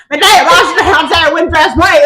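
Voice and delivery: mock the narrator's yokel Cassian; country bumpkin Cassian. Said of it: "when he did Cassian's voice".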